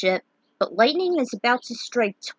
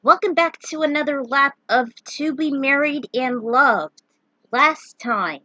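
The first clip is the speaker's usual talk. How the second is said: hype announcer; 4 semitones higher, 5 dB louder than usual. Acted. Hyped intro announcement.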